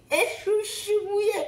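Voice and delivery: high, broken "chouchou mouillé" through laughter and tears; laughing and crying. Real, not acted. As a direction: let the joy break your voice. Témoignage émouvant. (rire mêlé de larmes, voix qui se brise)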